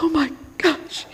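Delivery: high, squeaky sob ending in a gasp; mock crying. Preacher imitating an empathetic feeler, mock tearful. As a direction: well up and gasp, hammed-up. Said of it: "it's hard to be like".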